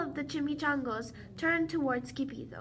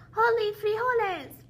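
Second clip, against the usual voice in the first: high, loud exclamation; cried out; 7 semitones higher, 6 dB louder than usual. A narrator's reading. Skipito crying out as he thrusts his sword, loud cry. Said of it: "cried Skipito".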